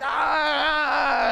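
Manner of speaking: long imitated tantrum cry; mad toddler wail. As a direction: wail like a toddler robbed of a toy. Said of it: "they're all mad at you".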